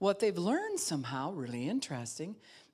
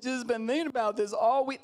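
higher, louder insistent whine; whiny protest; 8 semitones higher, 6 dB louder than usual. Retold or imitated. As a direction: protest like a stubborn churchgoer. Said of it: "what word are you standing on".

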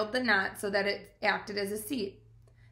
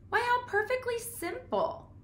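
high, light spider voice, much higher than the narrator; Charlotte's voice. A narrator's reading. Spider Charlotte, pleased with a sudden idea, in a high voice. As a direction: Charlotte delighted by her own idea. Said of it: "she said to herself".

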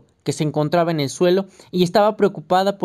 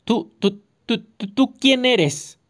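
higher, slow, stumbling man's voice; flustered stammer; 5 semitones higher, slower than usual. A narrator's reading. Fugitive on the phone to a stranger, mildly stammering. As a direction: trip over the words in a panic. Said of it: "un hombre que se apresuraba a hablar".

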